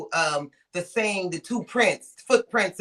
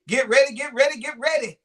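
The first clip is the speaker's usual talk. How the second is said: playful hype; 5 semitones higher, 3 dB louder than usual. Real, not acted.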